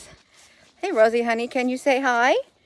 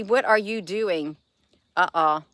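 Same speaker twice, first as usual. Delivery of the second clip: ordinary delivery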